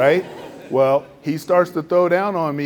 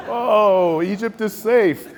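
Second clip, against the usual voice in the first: higher and louder, laughing it off; breezy dismissal; 8 semitones higher, 6 dB louder, slower than usual. Retold or imitated. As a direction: brush off the danger with a laugh. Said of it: "And he's like".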